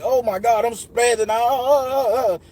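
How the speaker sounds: high, loud re-enacted spazzing; belligerent freak-out. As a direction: act out losing it, ending in wordless babble. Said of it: "I'm going fucking belligerent".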